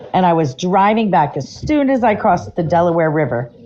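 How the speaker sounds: ordinary delivery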